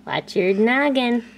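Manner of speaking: ordinary delivery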